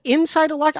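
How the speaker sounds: incredulous. Retold or imitated. Corey incredulous.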